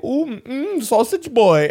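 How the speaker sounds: high, sing-song teasing voice; mocking kid voice. Schoolkids taunting him about sausage camp, high mocking sing-song. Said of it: "they did that voice to me".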